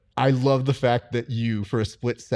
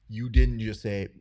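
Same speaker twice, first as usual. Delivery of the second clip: ordinary talk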